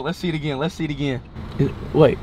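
ordinary talk

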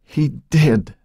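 high, tearful protest; acted sob of denial, pitched high; sobbing. A narrator's reading. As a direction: protest as if through tears. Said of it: "Joan sobbed".

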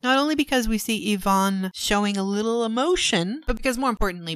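ordinary delivery

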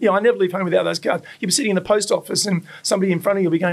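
ordinary talk, nothing marked

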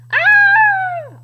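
high, loud "ah"; high-pitched pretend scream; mock scream. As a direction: shriek it high and long, for play. Reacts to scary dinosaur in story.

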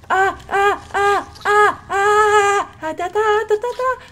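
high, loud frantic yelps; panicked squeals. Real, not acted. Player squeals while under attack, frantic.

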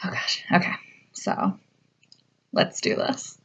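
hushed, breathy whisper to herself; nervous whispered setup. Real, not acted. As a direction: whisper as the video is about to play. Whispering before the trailer starts.

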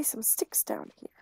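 whispered under the breath. Real, not acted. Player whispering to friends while searching the woods. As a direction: whisper it so nothing hears.

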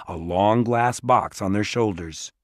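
ordinary delivery

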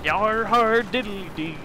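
slow, lightly hammed-up pirate ditty; mock pirate. Streamer filling time while gaming, slow mock pirate ditty. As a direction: drag it out like a joke pirate.

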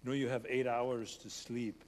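a hint of an Austrian bodybuilder's accent; light Austrian accent. Quoting Schwarzenegger's joke to the congregation, light Austrian accent. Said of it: "in this Austrian accent".

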